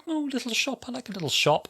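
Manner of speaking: very high giddy delight; silly doctor voice. Retold or imitated. Imitating the doctor's silly light side, very high. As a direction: coo over the shop like a child. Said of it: "kind of like".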